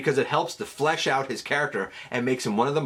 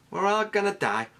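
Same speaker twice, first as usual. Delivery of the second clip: a hint of a higher squeaky Rat Trap; Rat Trap squeak; 7 semitones higher than usual. Fan recalling Rat Trap's line, light squeaky imitation. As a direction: lightly mimic the pessimist's squeak. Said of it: "said with that particular accent".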